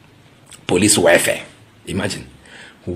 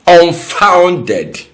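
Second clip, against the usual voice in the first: indignant; 11 semitones higher, 10 dB louder than usual. Real, not acted. Indignant verdict on the claim.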